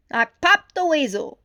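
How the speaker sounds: loud, high and triumphant; proud exclaim. A narrator's reading. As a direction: announce the catch. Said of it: "Judy exclaimed".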